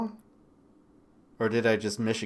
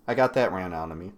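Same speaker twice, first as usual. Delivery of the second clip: ordinary delivery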